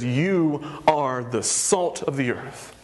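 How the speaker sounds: very slow, solemn declaration; Jesus declaring. Acted. Jesus speaks identity over the crowd, solemn. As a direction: let each word land like a proclamation. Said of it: "and he says".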